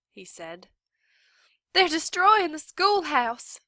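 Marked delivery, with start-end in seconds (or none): 1.7-3.6 much higher, loud, breathless alarm; teacher breathless at the sheriff's office; gasping for breath. A narrator's reading. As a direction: pant it out after running. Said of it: "gasping for breath".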